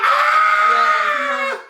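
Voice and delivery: very high, drawn-out imitated squeal; excited scream. Son imitating his mom hearing baby news, excited scream. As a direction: mimic an overjoyed mother's shriek.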